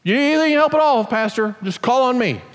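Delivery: high, fast, gushing church helper; mock sugary volunteer promising help; eager volunteer. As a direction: overeager offer to the pastor. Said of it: "I've had people say to me".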